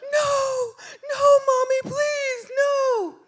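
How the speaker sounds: very high, loud mock-sobbing child; meltdown wail. Son begs mom not to send him to jail, acted wailing. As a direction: wail and beg like a kid in full meltdown. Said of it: "the meltdown begins".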